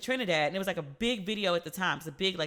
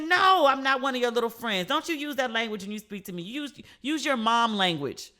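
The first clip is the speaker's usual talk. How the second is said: louder, indignant scolding; scolding daughter; 3 semitones higher, 4 dB louder than usual. Retold or imitated. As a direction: put your mother in her place firmly. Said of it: "I said".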